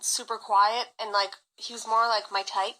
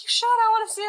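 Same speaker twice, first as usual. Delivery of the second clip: voiced ex coaxing; 11 semitones higher, 5 dB louder, faster than usual. Ex persuading.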